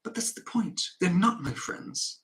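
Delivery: high-pitched, restrained wife's protest. Acted. Wife protests to husband.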